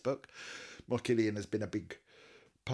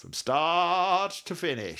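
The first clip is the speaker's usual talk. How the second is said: high, loud, stretched words; drawn-out boast; 8 semitones higher, 7 dB louder, slower than usual. Pretend. Host boasting of covering every set list, drawn out. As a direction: stretch it out grandly. Hammed-up boast about the series.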